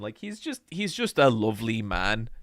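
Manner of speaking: ordinary delivery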